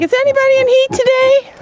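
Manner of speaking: high squeaky goat voice; voicing the goat. Narrating her buck's thoughts in a high goat voice. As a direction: speak for the goat in a high, silly voice. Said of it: "He's like".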